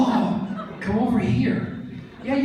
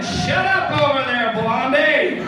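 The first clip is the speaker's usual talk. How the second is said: high, loud teasing call-out; playful shout; 11 semitones higher, 3 dB louder, slower than usual. Real, not acted. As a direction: yell across the room in jest. Teasing an audience member.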